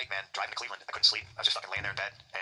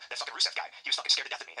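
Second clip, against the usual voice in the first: normal talking voice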